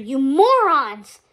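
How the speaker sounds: loud, drawn-out scolding; angry insult. A narrator's reading. Hades berates his useless minions, angry. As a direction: stretch the insult out loudly.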